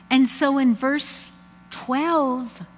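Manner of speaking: ordinary delivery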